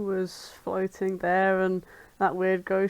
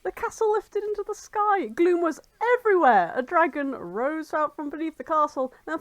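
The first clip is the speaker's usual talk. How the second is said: high, breathless rant; frantic scientist; 9 semitones higher than usual. Acted. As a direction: pour out the panic in one long high rush. Voicing Pura's frantic rant.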